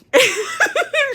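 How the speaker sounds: high burst of laughter. Real, not acted. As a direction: laugh at the memory. Laughing at own story.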